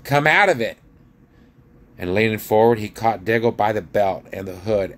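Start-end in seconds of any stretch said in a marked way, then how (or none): 0.1-0.8 loud, high sharp command; impatient king calling out; crying out. A narrator's reading. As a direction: order him out forcefully. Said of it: "cried Tyrion".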